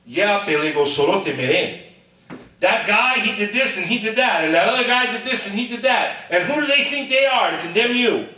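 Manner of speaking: high, loud, gossipy tattling; Jesus mockingly pointing at the accusers, loud and high; mock gossiping Jesus. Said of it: "but instead he said".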